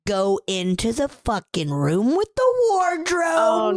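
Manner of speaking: high, slow emphatic build; excited. Real, not acted. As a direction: stretch it out with rising alarm. Building suspense in retelling.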